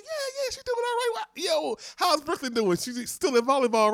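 cheerful small-talk voice; imitating the friend. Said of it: "they be like".

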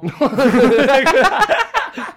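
high, loud real laughing fit; loud burst of laughter. Real, not acted. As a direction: crack up loudly at the joke. 讲到北原山猫大笑. (放声大笑)

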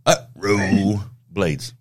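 UTRO drawn out as "uh... roooo", then BLADES; careful name attempt. Shown as a demonstration. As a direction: sound out Utro slowly in two separated parts, "uh, roo". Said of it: "I'm gonna try to say this name".

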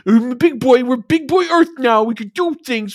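hammed-up kid insisting he's all grown up; mock bratty defiance. Mocking Archer as defiant kid.

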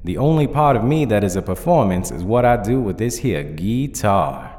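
lightly voiced dignified zombie cowboy; formal Southern cowboy. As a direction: explain with courteous Southern formality. Nail answers the judge formally.

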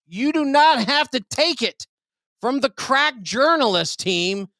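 high, hyped announcer voice; mock news anchor. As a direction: sell the scoop like breaking news.

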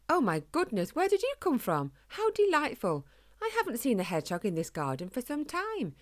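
startled, delighted posh exclamation, pitched high; posh English lady, high-pitched and delighted; posh old lady. A narrator's reading. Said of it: "had a very posh way of talking".

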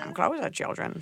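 high then fading breath, witchy; hammed-up witch beckoning children. Witchy grandma line.

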